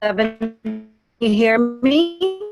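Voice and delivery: ordinary delivery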